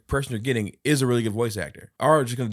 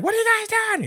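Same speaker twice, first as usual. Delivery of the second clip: high squeaky mock mom voice; high surprised mom; 6 dB louder, faster than usual. Uncle imitating the mom catching them at the door, high-pitched. Mom's quote.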